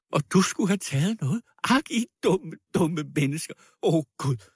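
high, distraught scorn; wild outcry. A narrator's reading. Woman defending the girl against the crowd, distraught. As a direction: exclaim in anguish at their stupidity. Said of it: "skreg med vild stemme".

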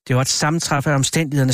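ordinary delivery, nothing marked

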